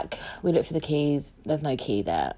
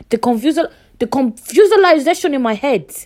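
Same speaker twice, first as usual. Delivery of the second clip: higher, louder mock-dramatic outcry; exaggerated bafflement; 6 semitones higher, 5 dB louder than usual. Storyteller overstating the confusion in her head, high and dramatic. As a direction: blow the confusion up theatrically.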